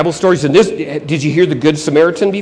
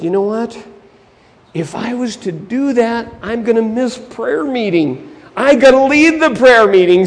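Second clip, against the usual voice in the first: higher-pitched excuse-making; lightly voiced reluctant preacher; self-important preacher; 7 semitones higher, 6 dB louder than usual. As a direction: brush the man off with an excuse.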